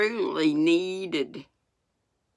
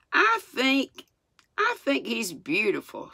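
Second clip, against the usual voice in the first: mildly determined, higher voice; a touch of resolve, pitched up; 7 semitones higher than usual. A narrator's reading. Mary gives Martha her opinion, mildly determined. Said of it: "said Mary in a determined voice".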